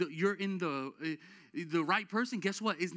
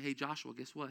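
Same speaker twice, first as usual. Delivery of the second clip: hushed lead-in; 4 semitones lower, 6 dB quieter than usual. Pretend. God leans in before commanding.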